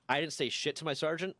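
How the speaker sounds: ordinary talk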